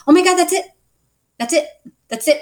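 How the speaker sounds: high, loud burst of eureka; retold sudden recognition. Client to therapist on finding the word, excited recognition. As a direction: reenact the moment the word clicks. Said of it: "And I'm like".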